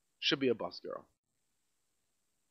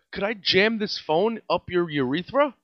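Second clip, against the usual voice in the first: ordinary talk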